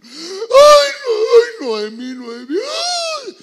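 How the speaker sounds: hammed-up wailing, high and very loud; mock sobbing cries, drawn out to a shriek; Orfa. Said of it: "Orfa llorando". (lamento llorón exagerado)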